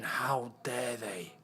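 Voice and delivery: quiet stunned outrage; low indignant murmur. Real, not acted. Indignant reaction repeated, quieter.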